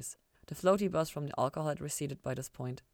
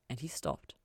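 plain reading voice

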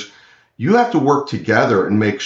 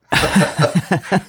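short, higher-pitched nervous laugh; 7 semitones higher than usual. Real, not acted. Guest laughing at own cheap home router, nervous laugh. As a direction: laugh uneasily in a quick run. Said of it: "it's a nervous laugh".

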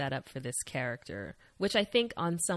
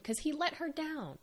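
high, softer mocking echo; mock pitiful repeat; 10 semitones higher, 6 dB quieter than usual. Shown as a demonstration. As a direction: parrot it in a high whiny voice. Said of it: "repeats it mocking".

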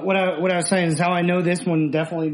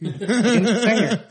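said through a laugh, higher and louder; 6 semitones higher, 4 dB louder than usual. Real, not acted. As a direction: blurt it out laughing. Laughing correction of co-host.

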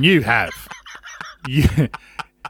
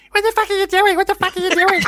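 put-on far higher pitched squeaky kid's scream at rivals; tiny little boy screaming. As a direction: yell like a furious little boy whose voice never broke. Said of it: "this tiny little boy's voice screaming".

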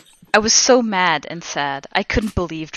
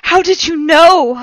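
high, loud, drawn-out astonishment; surprised; 12 semitones higher, 9 dB louder, slower than usual. A narrator's reading. Heroine questioning Voldemort's knowledge, hammed-up surprise. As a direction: gasp the question in exaggerated amazement. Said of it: "I asked in a surprised way".

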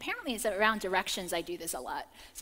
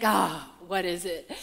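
wary sister; 4 semitones lower, 3 dB louder, slower than usual. Retold or imitated. Sister's wary reply, retold.

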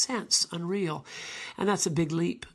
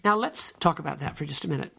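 ordinary delivery

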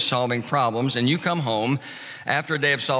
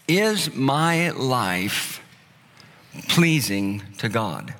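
very slow question; 2 semitones lower, much slower than usual. Acted. Repeating the key question slowly.

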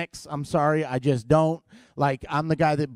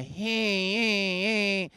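droning engine whine in repeated pulses; imitated car noise; 3 semitones higher, 4 dB quieter, much slower than usual. Car owner showing mechanic the noise, droning imitation. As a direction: mimic the faulty car, softly and steadily. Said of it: "I have to do things in noises".

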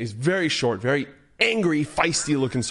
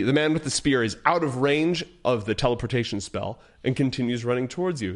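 usual speaking voice

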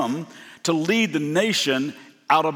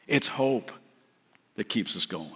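much softer, low final words; hushed climax; 4 semitones lower, 6 dB quieter than usual. Real, not acted. Preacher landing the point to the congregation, hushed and low. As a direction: drop to a near-hush.

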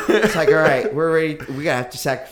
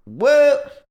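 surprised; 10 dB louder than usual. Real, not acted. Mock shock at a joke.

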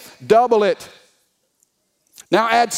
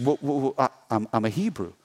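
low, softer stuttering reply; hesitant stammer; 6 semitones lower, 4 dB quieter, faster than usual. Acted. Jonah answering the sailors' questions, stammering. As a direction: stumble over the first words.

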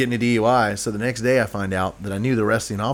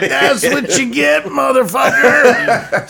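much higher and louder, slow, laughing through it; mock gloating yell; 13 semitones higher, 8 dB louder, much slower than usual. Cop retelling the fart story, laughing gloating shout. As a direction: crow it like a cop getting his revenge. Retold cop mocking drunk friend.

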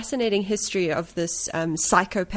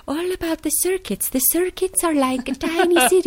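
high, airy dreamy [name] voice; whimsical voice; 10 semitones higher than usual. Retold or imitated. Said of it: "in this, like, whimsical voice".

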